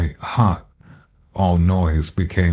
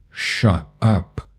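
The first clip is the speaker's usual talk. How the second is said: godlike force; 2 semitones higher than usual. A narrator's reading. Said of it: "He spoke again with that godlike force".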